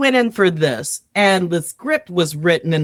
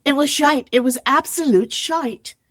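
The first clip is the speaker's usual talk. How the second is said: higher-pitched, hammed-up English accent; 5 semitones higher than usual. Shown as a demonstration. Showing the host the line again, in an English accent. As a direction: relish the swear word in mock English. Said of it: "do the bullshit line in the English accent".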